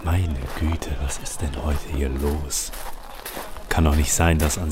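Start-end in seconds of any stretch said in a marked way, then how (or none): none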